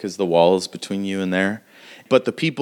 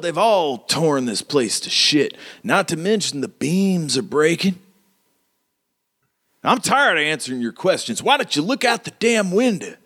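high-pitched, swooping character voice; indignant mayor; 8 semitones higher than usual. Acted. Mayor answering the woman who accuses him, high and indignant. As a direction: protest in a high, aggrieved frontier voice.

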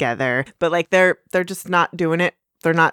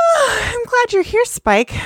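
Twilight, overjoyed. Acted. Twilight wakes and greets Spike, high and overjoyed. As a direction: burst out gladly, almost crying.

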